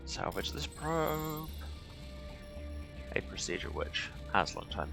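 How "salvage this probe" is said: usual speaking voice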